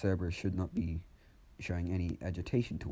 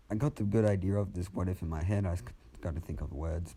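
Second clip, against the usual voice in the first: ordinary talk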